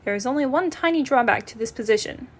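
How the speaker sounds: ordinary delivery, nothing marked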